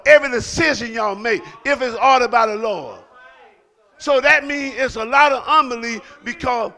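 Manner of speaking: preaching at full force. Acted. Pastor urging the congregation to answer, shouting. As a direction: whip up the crowd, shouting.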